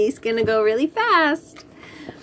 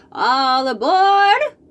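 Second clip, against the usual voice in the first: slow, louder conductor call; 5 semitones higher, 6 dB louder, slower than usual. A narrator's reading. Conductor calling passengers aboard, slow call. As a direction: draw it out like a conductor. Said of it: "calls the conductor".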